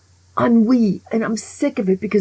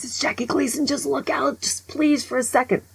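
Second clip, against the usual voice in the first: mock pleading waiter, pitched up; 6 semitones higher than usual. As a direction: beg the chef to just look. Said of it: "So the guy goes".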